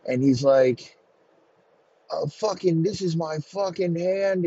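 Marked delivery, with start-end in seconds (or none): 2.1-4.5 high-pitched hammed-up wail of pain; mock screaming. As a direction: wail like a wounded man, without full volume. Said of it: "he's screaming about his fucking hand".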